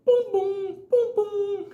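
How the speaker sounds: very high hooted booms; high falsetto thuds in pairs; imitated banging noise. Driver imitating a strange sound he heard, high hooted booms.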